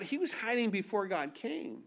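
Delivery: ordinary talk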